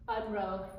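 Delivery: ordinary talk, nothing marked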